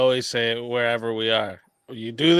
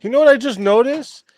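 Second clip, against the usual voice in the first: accusing panelist; 7 semitones higher, 4 dB louder than usual. Retold or imitated. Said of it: "out of nowhere he goes".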